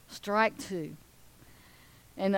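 usual speaking voice

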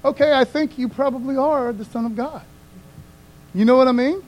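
ordinary delivery

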